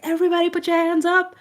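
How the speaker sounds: high, pumped-up party hype call; rally the crowd like a club hype man. Retold or imitated. Imitating a party hype man working up the room. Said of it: "you get a hype man at a party that's like".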